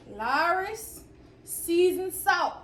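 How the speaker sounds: Lawry's stretched out, seasoned salt drawn slow; announcing each word; slow careful naming. Shown as a demonstration. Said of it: "I might not be saying it right".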